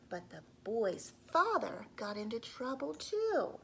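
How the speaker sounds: ordinary delivery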